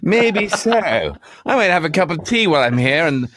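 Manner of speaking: high-pitched mock-posh retort; hammed-up batsman. Co-host parodying the batsman's comeback, hammed-up high voice. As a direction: ham it up high, each phrase swooping down at the end.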